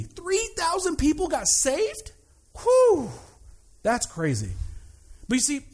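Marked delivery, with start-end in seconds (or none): 2.6-3.1 loud high whew of amazement; whoop of amazement. Real, not acted. Amazed at mass baptisms.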